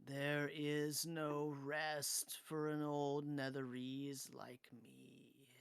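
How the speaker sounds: whispered slow ghost voice, fading away; drawn-out spectral whisper; cursed spirit. Acted. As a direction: whisper wearily as a restless spirit, dying off at the end.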